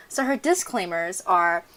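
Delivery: ordinary delivery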